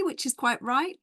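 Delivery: ordinary talk, nothing marked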